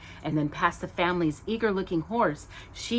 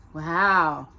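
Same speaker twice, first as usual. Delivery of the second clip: usual speaking voice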